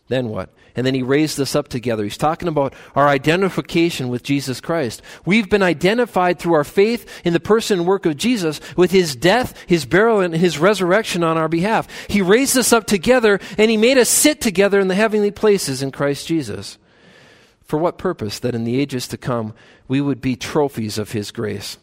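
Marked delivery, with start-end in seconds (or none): 5.2-16.8 higher, louder rising sermon climax; preaching at full force. Acted. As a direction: build it up like a pulpit crescendo.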